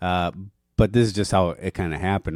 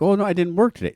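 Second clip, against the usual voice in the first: high mock wife voice; lightly mimicking his wife, pitched up; imitating wife; 10 semitones higher, faster than usual. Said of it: "She's like".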